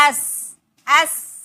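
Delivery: loud, drawn-out "एस", vowel first; isolated opening sound. Shown as a demonstration. Teacher asking what sound comes, loud slow "एस" starting on the vowel. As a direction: stretch "एस" slowly as "ess". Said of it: "एस बोल रहा है ना".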